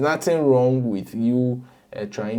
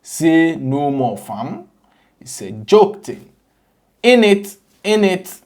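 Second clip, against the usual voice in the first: hammed-up London roadman accent, higher and louder; playful fake London accent, punchy; mock London slang accent; 6 semitones higher, 8 dB louder than usual. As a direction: put on a jokey London street accent. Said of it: "fa accent no hook yɛn la".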